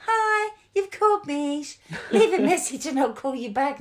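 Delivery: chirpy answering machine message; voicemail greeting voice. Retold or imitated.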